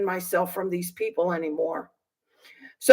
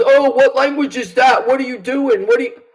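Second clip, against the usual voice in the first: mocking cop; 3 semitones higher, 5 dB louder than usual. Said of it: "So he goes".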